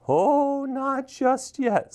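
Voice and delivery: high, slow teasing spirit; drawn-out ghostly reassurance; mysterious voice. A narrator's reading. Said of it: "said the voice".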